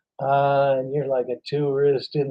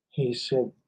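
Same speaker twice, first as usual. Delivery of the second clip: usual speaking voice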